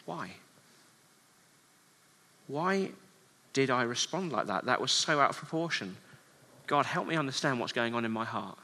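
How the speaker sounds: soft, searching prayer to God; quiet self-questioning. Pretend. A believer quietly asking God about their anger. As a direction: ask yourself gently, almost under your breath.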